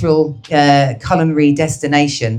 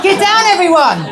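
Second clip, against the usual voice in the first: mock alarm.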